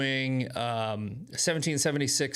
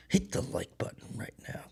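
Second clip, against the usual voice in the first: soft conspiratorial whisper; whispered urging; 4 semitones lower, 6 dB quieter than usual. Pretend. Urging viewers to like the video, whispered. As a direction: lean in and hiss the request.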